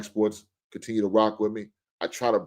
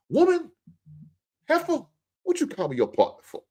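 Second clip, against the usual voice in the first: mock outraged wife. Wife yelling at her husband for calling her partner, high mock outrage. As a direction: shriek it like a wife insulted.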